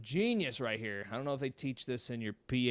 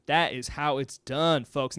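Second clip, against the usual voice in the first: normal talking voice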